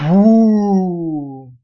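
loud wolf blowing breath; big blow. Acted. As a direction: blow hard. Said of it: "волк начал дуть".

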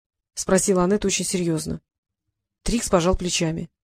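ordinary delivery, nothing marked

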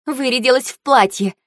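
high-pitched quarrelsome jibe; catty taunt. A narrator's reading. Girl mocking her rival's dress, high and catty. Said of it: "выдала Фэй-Фэй".

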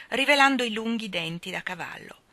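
ordinary delivery

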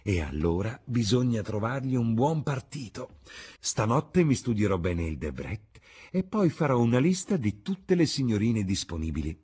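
eager hostess. Acted. Hostess planning a match for her guest, eager.

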